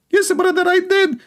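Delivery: very high squeaky mock reply. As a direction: pitch way up to mock.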